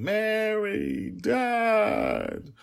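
very high, drawn-out wail; crying child. Acted. Child cries for her lost nanny and her father, wailing. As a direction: wail the names like a small child in tears. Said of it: "Dragon Little was crying".

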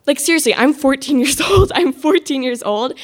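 emphatic, exasperated reality check; exasperated outburst. Retold or imitated. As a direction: burst out in disbelief at your own panic. Retelling her freak-out over careers.

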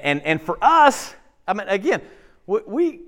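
ordinary delivery

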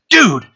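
a single drawn, falling "Dude" greeting; emphatic dude. Shown as a demonstration. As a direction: call out "Dude" like you're pulling someone in. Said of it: "you can use different emphases on".

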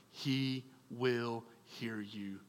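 HE WILL HEAR YOU slowed right down, low and soft; solemn reassurance. Real, not acted. Preacher promising the fallen away, slow quiet weight on "he will hear you".

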